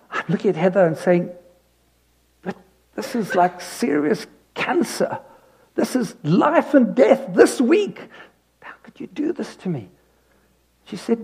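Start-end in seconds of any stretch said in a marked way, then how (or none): 2.4-9.9 high-pitched incredulous plea, fading to a hush; a husband pleading with his wife, high then hushed; mock alarmed protest. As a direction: replay the panic, then let it sink to almost nothing.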